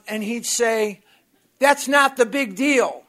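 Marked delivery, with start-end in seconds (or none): 1.6-3.0 loud, higher, slowing mock yell; retold yelling. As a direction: build into a yell at him. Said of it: "he was yelling at me".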